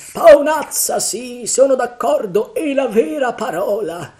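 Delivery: very high, loud character voice; agitated pirate. A narrator's reading. Pirate agreeing about dead Flint's face, high agitated cry.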